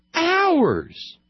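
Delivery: loud, high, fed-up outburst; exasperated shout. Real, not acted. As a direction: blow up at four lost hours. Exasperated rant about four hours.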